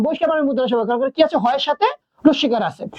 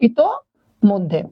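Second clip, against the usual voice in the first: ইতোমধ্যে drawn out very slowly, syllable by syllable; slow clear pronunciation; 4 semitones lower, much slower than usual. Shown as a demonstration. Teacher showing students how to say ইতোমধ্যে, very slowly and clearly. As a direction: say ইতোমধ্যে slowly so students hear each part. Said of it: "এরকম ভাবে শব্দ উচ্চারণ করব".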